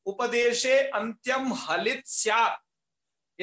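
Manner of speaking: each word of उपदेशे अंत्यम हलित स्यात् drawn out for memorising; slow recitation. Shown as a demonstration. Teacher reciting the sutra to students, slowly and deliberately. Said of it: "उसको संस्कृत में याद कर लीजिएगा".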